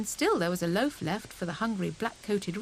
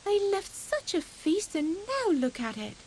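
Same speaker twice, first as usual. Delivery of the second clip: high, distressed; upset Grey Rabbit; 10 semitones higher than usual. A narrator's reading. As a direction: grieve over the mess.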